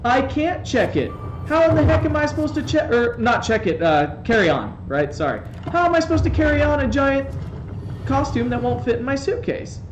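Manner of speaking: high, loud, disbelieving rant; exasperated protest. Retold or imitated. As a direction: plead your case, incredulous and worked up. Retelling his protest at check-in.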